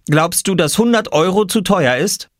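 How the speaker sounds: put-on high child's voice, questioning; boy salesman. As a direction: pitch it high like a hopeful boy. Boy asks about his price. (fragende, hohe Kinderstimme)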